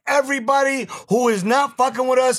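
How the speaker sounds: hype podcast host. Acted. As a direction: pump up the live audience like an MC. Hosts the show intro.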